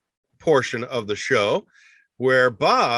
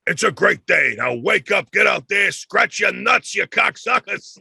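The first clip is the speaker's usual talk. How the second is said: high, loud, brash comic impression; loud pep talk, stretched at the end; Joey Diaz; 7 semitones higher, 4 dB louder than usual. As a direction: holler it like a crude motivational wake-up call. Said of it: "He's just like".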